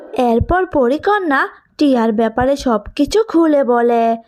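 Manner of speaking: ordinary delivery, nothing marked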